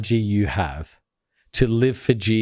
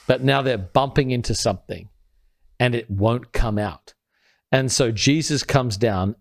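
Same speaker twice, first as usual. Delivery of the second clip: usual speaking voice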